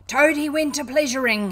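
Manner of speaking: loud, high defiant yell. A narrator's reading. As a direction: yell the song line as a battle cry. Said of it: "he yelled".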